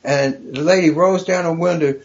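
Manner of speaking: usual speaking voice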